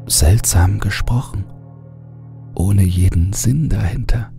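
ordinary delivery, nothing marked

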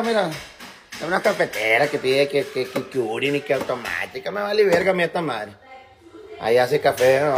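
usual speaking voice

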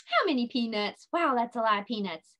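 ordinary talk, nothing marked

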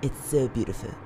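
flirty. Acted. Flirting with the masked killer.

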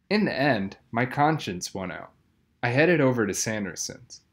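usual speaking voice

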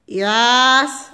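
drawn-out reluctant yes, mocking; mock weary drive-thru answer; imitated monotone. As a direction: drag out the word like bored staff. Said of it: "very, like, monotone".

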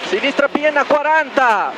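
co-driver calling the road ahead; rally pace notes. Real, not acted. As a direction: bark each note crisp and fast for the driver.

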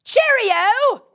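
put-on British accent. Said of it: "heard a Galilean speaking British".

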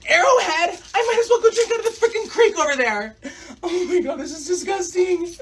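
high-pitched, hammed-up diva disgust; spoiled whining. Daughter to dad at campsite, whining in outrage.

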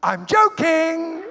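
slow, high, loud reveal; imitating the man's loud slow gotcha; mock shout. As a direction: bellow the punchline gleefully. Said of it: "he looked at me and he went".

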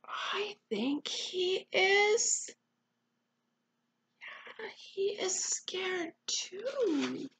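slow, half-whispered realisation; hushed. Real, not acted. Reader telling a child the bear is scared, hushed. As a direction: share it slowly in a hush. Hushed reply about bear's fear.

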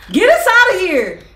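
loud, high cry to be let out; panicked shout. Real, not acted. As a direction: yell it like you are trapped. Trapped in the apartment.

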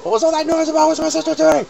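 very high, loud squeaky voice; shrill little voice. Acted. Worried sibling asking about Tooty, very high squeaky voice.

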